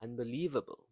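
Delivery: "unbelievable" in one quick run, still stressed on "lie"; said quickly. Shown as a demonstration. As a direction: say "unbelievable" briskly without breaking it up. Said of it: "You have to say it quickly".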